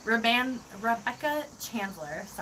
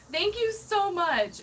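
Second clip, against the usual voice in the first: high, drawn-out gushing thanks; heartfelt gratitude; 10 semitones higher, 3 dB louder than usual. Real, not acted. Streamer thanking a viewer for kind words, gushing. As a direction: gush it slowly, pitched right up.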